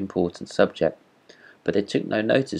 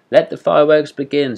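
a king's call, pitched high and louder; royal command; 7 semitones higher, 7 dB louder than usual. A narrator's reading. King opening the midnight fireworks, ringing command. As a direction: proclaim it to the whole court. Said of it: "said the king".

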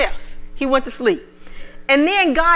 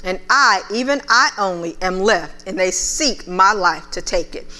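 lower, measured Bible reading; preacher reading from the King James; scripture reading; 4 semitones lower than usual. Acted. Reads scripture aloud.

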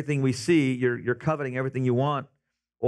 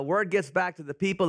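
ordinary delivery

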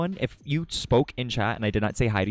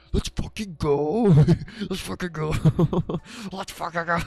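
excited cheer; 7 semitones higher, 3 dB louder than usual. Real, not acted. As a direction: stretch out the victory cry. Hyped over the cheese.

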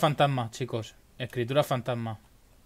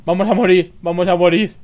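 loud panicked cry; panicking gamer; 4 semitones higher, 7 dB louder than usual. Real, not acted. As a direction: shout in fear of dying. Monster chasing players.

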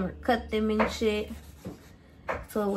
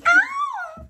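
high loud ow; sharp yelp; 6 dB louder than usual. Real, not acted. Cutting onions, she yelps suddenly: high loud ow. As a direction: yelp as if stung.

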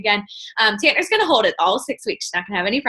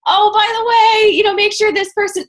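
mock reminder; 11 semitones higher, 5 dB louder than usual. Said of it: "I have to be like".

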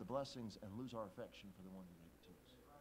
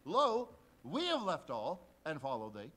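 louder, slow proclamation; Peter piping up; 2 semitones higher, 13 dB louder, slower than usual. Acted. Peter telling Jesus what they gave up, loud and slow. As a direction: declare it like a proud Peter.

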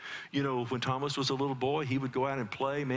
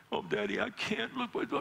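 put-on much higher, whiny tearful little boy; crying child; 12 semitones higher than usual. Hurt son to his father, mock tearful whine. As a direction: whimper like a hurt toddler.

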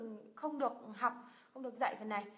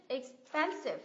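expensive with PEN emphasised, then falling; stress second syllable; much slower than usual. Shown as a demonstration. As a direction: stretch the middle beat, 'ex-PEN-sive'. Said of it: "cái âm thứ hai này mình nhấn này".

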